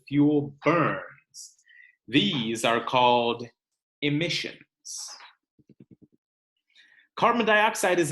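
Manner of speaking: lively read-aloud, bigger on the lists; storybook reading for a classroom; reading to children. Acted. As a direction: read the book to the class with energy. Teacher reads book to class.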